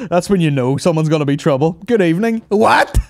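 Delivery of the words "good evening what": [name] impression. Mimicking Ozzy's greeting and confusion.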